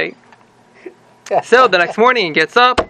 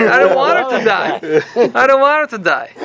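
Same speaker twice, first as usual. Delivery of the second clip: mock tearful plea; 4 semitones higher than usual. Husband pleads, now loves wife.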